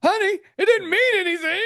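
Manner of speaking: high, pleading excuse to the wife; mock pleading husband. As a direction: play the guilty husband, voice up high.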